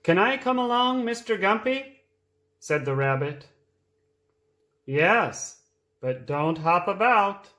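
reading a picture book aloud; lively read-aloud for small children; storytime voice. A narrator's reading.

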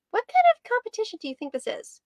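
high, incredulous interrupting question; indignant question. Acted. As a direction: cut him off in disbelief. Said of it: "Ruby interrupted".

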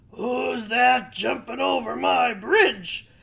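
loud, slow troll shout. A narrator's reading. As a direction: roar it out slowly as a troll. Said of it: "said the troll".

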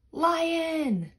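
high panicked cry of lion; gasp, then shout lion in fright; alarmed cry. Acted. Badger sees the lion.

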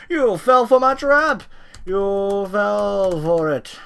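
high, loud gloating villain; mad scientist. Acted. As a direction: crow over the trapped foe, slow at the end. Gloating villain after springing a trap.

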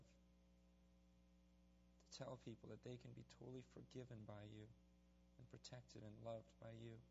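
reverent prayer to God; closing prayer. Acted. Pastor closing the service praying for the congregation, reverent prayer. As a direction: pray humbly and sincerely aloud.

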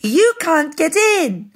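high, loud jeer; squeaky singsong mockery; gleeful piggy taunt. A narrator's reading. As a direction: taunt the wolf from safety. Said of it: "laughed the three little pigs".

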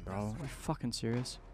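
usual speaking voice

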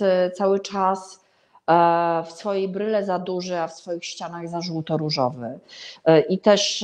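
normal talking voice

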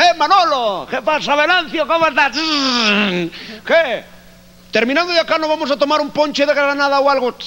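high, Spanish Galician voice; Galician Spanish accent. Retold or imitated. Two gallegos chatting in a high Spanish accent. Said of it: "un gallego".

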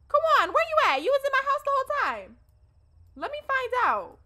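loud high surprised calling. Real, not acted. Player calls to Kawan found in her house, loud and surprised. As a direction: shout as if catching someone out.

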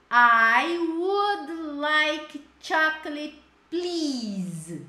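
slow loud model. Shown as a demonstration. Teacher modeling the answer for students, slow and loud. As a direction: say it slowly for the class to copy.

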